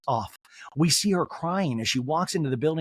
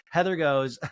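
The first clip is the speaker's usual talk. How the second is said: usual speaking voice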